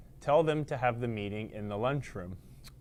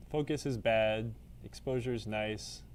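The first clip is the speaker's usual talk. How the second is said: ordinary talk, nothing marked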